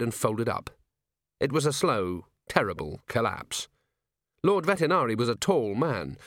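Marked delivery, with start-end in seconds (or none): none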